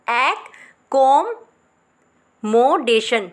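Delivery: एक, को, मोडेशन with pauses; syllable by syllable. Shown as a demonstration. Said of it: "clear हो गया होगा". (रुक रुक कर)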